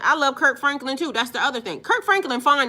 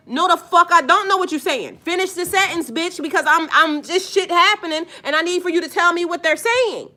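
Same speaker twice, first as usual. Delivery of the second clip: raised, louder exasperated comeback; angry retort; 6 semitones higher, 4 dB louder than usual. Retold or imitated. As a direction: fire it back at them, fed up and loud.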